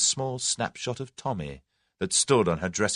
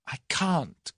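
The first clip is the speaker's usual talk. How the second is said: gasped refusal; 8 semitones higher than usual. A narrator's reading. Tuppence refusing Julius's proposal, gasping. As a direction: gasp it, overwhelmed. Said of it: "gasped Tuppence".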